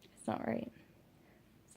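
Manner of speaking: a breathy whisper to herself. Real, not acted. Mother on strangers touching her baby, whispered. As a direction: drop to a real whisper. Sudden unvoiced aside after pause.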